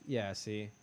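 ordinary talk

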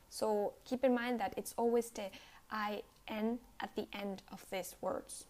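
ordinary talk

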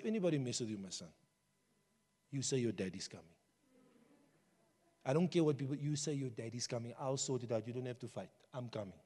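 reassuring father. Retold or imitated. Father reassuring his child.

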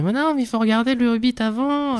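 high, huffy mock purist objection. Cohost mocking Tolkien purists in a high voice. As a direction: squeak it out like an offended purist. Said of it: "certains puristes te diraient".

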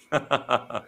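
low, soft laugh; chuckle. Real, not acted. After quoting a touching comment, low soft chuckle.

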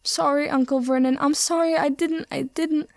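high, frightened pleading; beg, whimpering and scared; a terrified boy whimpering apologies. A narrator's reading. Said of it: "Harry whimpered".

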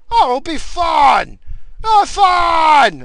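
high, loud, drawn-out goofy shout; mocking coworker impression. Coworker pushing his game, mocking high shout. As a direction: holler it like a caricature of an over-eager pest.

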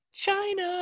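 high put-on voice; mock Chinese-accented voice. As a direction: snap the answer out in a squeaky voice. Punchline answer, high put-on voice.

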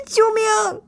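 very high desperate cry; screaming while pinned under a paw; panicked scream for help. A narrator's reading. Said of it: "吓得拼命地挣扎大叫". (惊恐地大喊救命)

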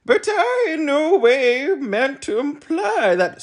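very high, slow, loud plea; insisting humbug. A narrator's reading. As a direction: insist politely but firmly. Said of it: "insisted the humbug".

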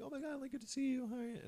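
very high, fast, soft greeting squeal; mock high-voiced friendly hello; high-pitched greeting. Acted greeting between friends at restaurant.

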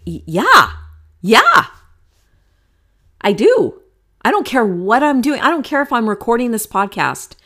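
loud, high, eager yeses spilling over; excited. Retold or imitated. Mom answering her grown son's invite to talk, excited. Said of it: "I do get excited".

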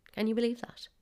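ordinary talk

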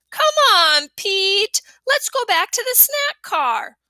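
high, eager little brother pleading; a small boy begging to go back; little kid voice. A narrator's reading. Fudge voiced by narrator.

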